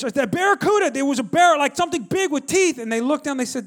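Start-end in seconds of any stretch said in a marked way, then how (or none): 0.1-2.8 high, loud panicked explanation; mock-terrified, scrambling for the words; frantic insistence. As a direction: blurt it out in acted alarm. Frantic self insisting to the guides.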